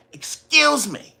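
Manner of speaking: high, slow, drawn-out excuse me; mock aggressive. Scared person imitating how he said it, mock aggressive. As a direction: stretch it out as an exaggerated mock-aggressive version. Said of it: "when you say it like that".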